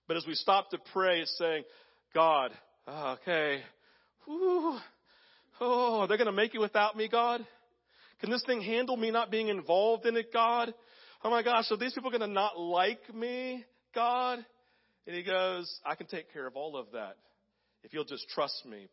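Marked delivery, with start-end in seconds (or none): 2.1-14.4 anxious prayer. Pretend. 15.8-18.9 softer, calm reassurance; quiet, steady assurance; reassuring God. Pretend. Voicing God's reassurance.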